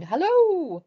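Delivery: high, loud call of Hello; Tigger's high calling Hello. Acted. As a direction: sing out a long, bright Hello from afar. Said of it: "still calling".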